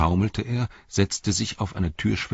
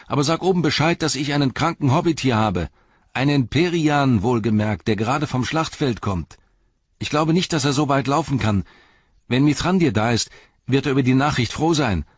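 put-on higher, louder urgent young hobbit; Pippin calling out urgently; 8 semitones higher, 4 dB louder than usual. A narrator's reading. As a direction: call the boy back before he runs off. Pippin calls to Bergil.

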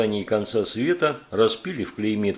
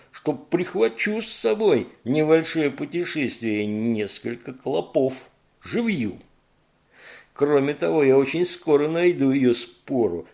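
gurgling voice; 4 semitones higher, 4 dB louder than usual. Acted. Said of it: "ответил булькающий голос".

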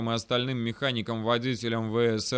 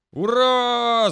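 high, loud drawn-out cheer; jubilant shout; 10 semitones higher, 9 dB louder than usual. A narrator's reading. Brothers cheering as the engine starts, jubilant shouting. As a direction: belt out a victory cry. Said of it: "заорали братья".

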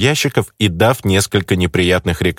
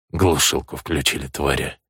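much lower and softer, seething; bitter low curse; 6 semitones lower, 7 dB quieter than usual. A narrator's reading. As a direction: spit the curse under your breath. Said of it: "Тон и пара слов". (глухо и зло)

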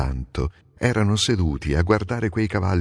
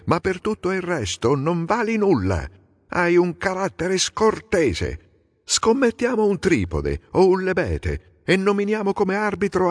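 irritated retort; 9 semitones higher than usual. A narrator's reading. Idomeneo retorting to Aiace's insult, high irritated reply. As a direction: snap back in offended pride, pitch way up. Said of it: "gli rispose stizzito".

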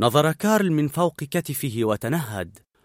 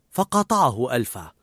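ordinary delivery

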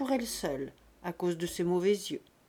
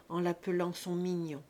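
ordinary delivery

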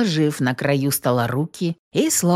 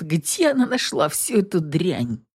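usual speaking voice

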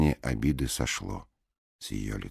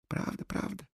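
soft, breathy правда, правда; fading insistence; 9 dB quieter than usual. A narrator's reading. Alice quietly repeating to the blonde, fading. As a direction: let правда, правда fade to a breath.

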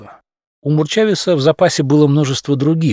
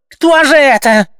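shrill, much higher, louder cry; hysterical question; 14 semitones higher, 7 dB louder than usual. A narrator's reading. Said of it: "истеричного вопроса".

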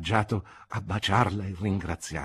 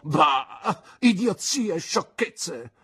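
louder and higher, slow scornful snort; scornful exclamation; 8 semitones higher, 6 dB louder, slower than usual. Acted. Aunt scoffs at Mister Marston, scornful and loud. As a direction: scoff it off hard. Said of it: "esclamò mia zia".

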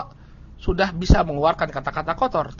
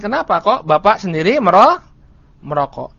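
ordinary delivery

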